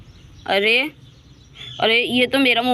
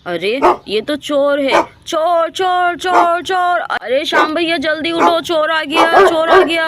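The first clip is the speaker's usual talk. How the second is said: high, loud alarm cries; shouting thief; 8 semitones higher, 4 dB louder than usual. Acted. Ram to the colony, high loud alarm shouts. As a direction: raise the whole colony. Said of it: "Ram चिल्ला चिल्ला के".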